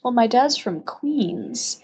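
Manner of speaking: ordinary delivery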